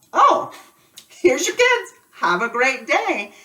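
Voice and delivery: sugary teacher voice pushing them out the door; forced cheery. Retold or imitated. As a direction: hurry the parents out with a bright smile. Rushing parents out of class.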